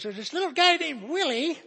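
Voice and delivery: high, loud Scottish-accent imitation; Jim McGuigan. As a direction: put on a high Scottish storyteller's voice. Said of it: "he's, uh, Scottish".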